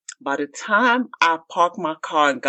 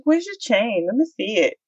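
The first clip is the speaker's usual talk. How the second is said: fake sweet; 6 semitones higher than usual. Sweetly asking for chain.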